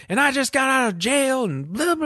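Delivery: high put-on voice of a rambling woman; mock rambling neighbor. Mimicking the neighbor who just got out of jail, high and rambling. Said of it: "She's like".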